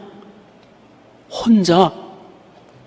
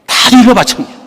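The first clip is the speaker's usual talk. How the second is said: high, loud, cheeky; loud playful declaration; 8 semitones higher, 5 dB louder than usual. Real, not acted. Preacher telling the congregation she tattles, high loud exclaim. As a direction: announce it like a proud tattletale.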